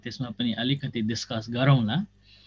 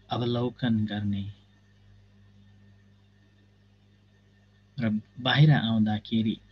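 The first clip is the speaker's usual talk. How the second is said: measured instructions with long silences; guided meditation. Real, not acted. Teacher guiding students to observe the body, guided meditation. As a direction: guide them step by step, pausing between phrases.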